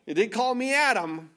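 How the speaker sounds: normal talking voice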